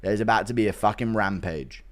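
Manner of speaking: usual speaking voice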